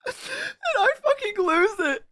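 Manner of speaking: high, squeezed out through laughter; laughing through words. Real, not acted. Streamer tells chat how they lost it, laughing through words. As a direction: barely get it out while cracking up. Still laughing as the story resumes.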